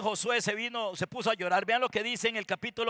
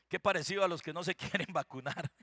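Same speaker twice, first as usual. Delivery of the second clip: softer, lower aside breaking into laughter; amused chuckle; 5 semitones lower, 8 dB quieter than usual. Real, not acted. Preacher slipping in a joke about the unvaccinated, chuckling. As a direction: chuckle through the last words.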